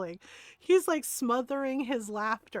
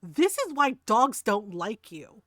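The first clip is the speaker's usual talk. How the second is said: snarky comeback; 3 semitones lower, 4 dB louder, slower than usual. Retold or imitated. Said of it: "immediately turns around and goes".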